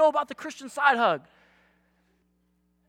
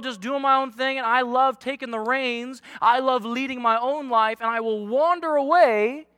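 usual speaking voice

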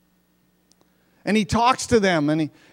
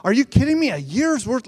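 much higher, incredulous outburst; mock disbelief; 7 semitones higher than usual. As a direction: play the outraged onlooker. Mock reaction to wasted wages.

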